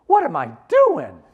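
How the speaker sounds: loud, high, drawn-out self-exclamation; thinking out loud. Acted. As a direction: cry out to yourself in mock exasperation. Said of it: "It's thinking out loud".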